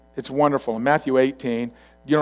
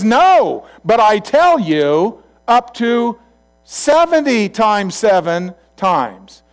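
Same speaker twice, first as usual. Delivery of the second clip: loud, raised, slow proclamation; emphatic correction; 8 semitones higher, 8 dB louder, much slower than usual. Acted. Jesus correcting Peter on how often to forgive, emphatic. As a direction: overrule it firmly, drawing out each phrase.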